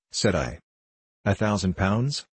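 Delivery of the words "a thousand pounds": "A thousand pounds" with the pitch rising on "pounds"; questioning. A narrator's reading. Buyer repeats the price back, questioning "A thousand pounds" with rising end. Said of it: "said he".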